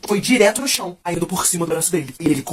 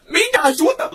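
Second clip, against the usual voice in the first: stunned disbelief; 12 semitones higher, 3 dB louder, slower than usual. Retold or imitated. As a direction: voice leaps high in disbelief. Disbelief at the driver's request.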